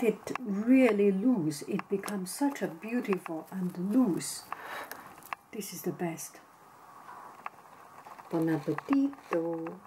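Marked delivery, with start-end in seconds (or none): 5.5-6.4 hushed whisper. Real, not acted. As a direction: breathe it out, whispering. Quiet tasting aside.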